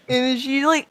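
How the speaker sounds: ordinary talk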